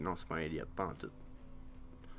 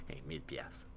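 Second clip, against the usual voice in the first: very soft, low murmur; near-whispered, low musing; hushed aside; 8 semitones lower, 14 dB quieter than usual. Real, not acted. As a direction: say it under your breath. Muses on the imagined price.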